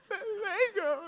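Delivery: put-on a sad four-year-old pining; pathetic child. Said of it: "She's like".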